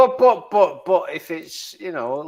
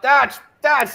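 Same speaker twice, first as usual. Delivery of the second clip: put-on higher-pitched child nagging Dad; imitated nagging daughter; 6 semitones higher than usual. Man's daughter interrupting his stream, imitated nagging. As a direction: mimic a daughter bossing her dad. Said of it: "she's like".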